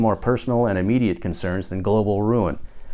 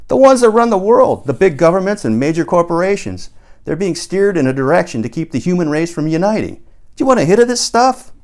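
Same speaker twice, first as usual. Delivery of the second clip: much higher and louder, excited conspiracy rant; Paul, fired up; 8 semitones higher, 7 dB louder than usual. A narrator's reading. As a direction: sell the big reveal at full pitch. Narrator voices Paul excited.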